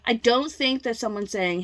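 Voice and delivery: ordinary talk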